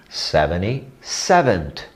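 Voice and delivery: Seventy-seventh said slowly and deliberately; slow model pronunciation. Shown as a demonstration. Teacher showing the ordinal, slow model word. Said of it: "vai ser T H".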